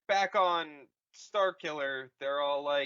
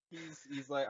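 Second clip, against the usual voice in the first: ordinary delivery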